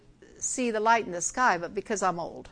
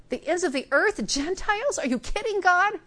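incredulous disciples; 6 semitones higher than usual. Retold or imitated. Disciples balk at Gentiles.

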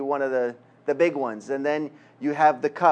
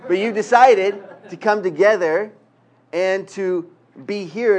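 higher and louder, laughing with delight; excited laugh; 4 semitones higher, 7 dB louder, slower than usual. Real, not acted. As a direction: lift it up joyfully, still chuckling. Laughing, lifting voice to congregation.

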